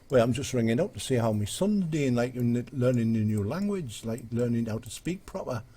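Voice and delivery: lively Geordie with swinging lilt; Geordie accent. Acted. Geordie father ringing to check on his son, in a lilting Geordie accent. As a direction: do a Geordie dad on the phone. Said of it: "Geordie chappie rings the office".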